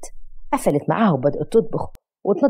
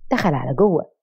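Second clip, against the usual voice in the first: ordinary delivery, nothing marked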